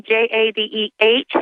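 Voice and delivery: ordinary talk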